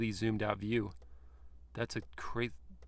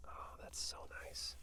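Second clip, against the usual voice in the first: admiring whisper; 17 dB quieter than usual. Real, not acted. Reviewer whispering praise to the sliding cup holder. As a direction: hush it like a secret delight.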